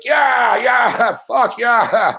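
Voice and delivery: shouted yeah of joy; jumping up with joy, shouting yeah; retold cheer. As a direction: cheer like you just won. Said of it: "I was like".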